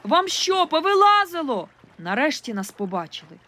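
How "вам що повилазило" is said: high, loud irritated yell; girl yelling at the boat crew; exasperated shout. A narrator's reading. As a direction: snap at the blind rescuers. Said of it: "крикнула я знов".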